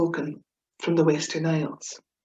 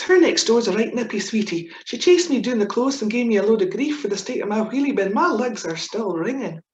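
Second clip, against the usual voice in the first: broad Scots; 3 semitones higher than usual. Shown as a demonstration. Reading out her broad Scots example sentence. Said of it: "what we do is we always put a little bit into context".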